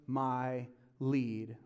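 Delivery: slow, gentle, soft reassurance; slow and calm; God's calm voice. Acted. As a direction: speak slowly like a patient father. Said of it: "God says".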